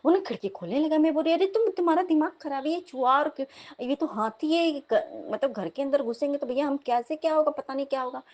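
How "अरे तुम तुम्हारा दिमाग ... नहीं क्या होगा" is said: high, agitated telling-off; panicked scolding. Retold or imitated. Wife scolding husband for opening the window, panicked. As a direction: scold in alarm, pitch raised.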